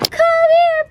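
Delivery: high, loud call to a pet; calling the dog. Real, not acted. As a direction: call out across the room to the dog.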